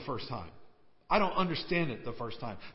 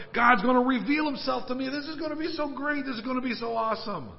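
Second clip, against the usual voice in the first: high, loud gush that speeds up at the end; breathless-with-hope enthusiasm, pitched way up; excited anticipation; 7 semitones higher, 4 dB louder than usual. Retold or imitated. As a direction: imitate someone thrilled about what's coming. Voices Elijah's excited anticipation.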